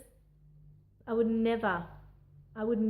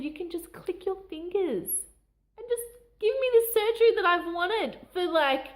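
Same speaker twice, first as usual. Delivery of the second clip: much higher and louder, breaking with emotion; overjoyed; 9 semitones higher, 5 dB louder than usual. Retold or imitated. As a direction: blurt it out, overwhelmed with relief. Overjoyed patient crying with relief.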